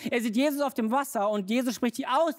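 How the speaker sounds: ordinary delivery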